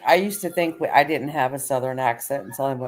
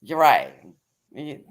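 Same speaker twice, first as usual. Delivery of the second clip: usual speaking voice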